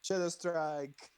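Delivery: slow, loud battle cry; dramatic attack call. Acted. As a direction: announce the move with flair.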